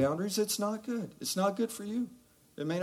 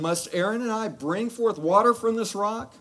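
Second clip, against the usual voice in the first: higher and louder, exasperated; ticked off Moses; 7 semitones higher, 8 dB louder than usual. Retold or imitated. Moses, fed up with the people, scolding them at the rock. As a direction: huff it out like a fed-up leader. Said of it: "Moses is kinda ticked off".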